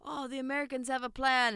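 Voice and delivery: lightly voiced British accent; a half-hearted posh British officer; bad British accent. Retelling a movie scene, weak British accent. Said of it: "I did that accent terrible".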